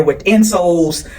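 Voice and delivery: ordinary talk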